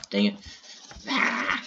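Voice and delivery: ordinary delivery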